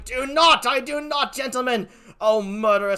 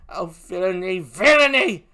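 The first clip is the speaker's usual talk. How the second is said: anguished outcry; 7 dB louder than usual. Acted. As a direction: cry it out in horror. Emilia's grief and rage.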